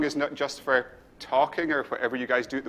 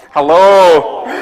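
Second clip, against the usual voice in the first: loud laughing hello in a mock Scottish accent; hammed-up Scottish greeting, laughing; 6 semitones higher, 15 dB louder, much slower than usual. Shown as a demonstration. Brewer leads viewers in a Scottish-accent hello, loud and laughing. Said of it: "bonus points for Scottish accent".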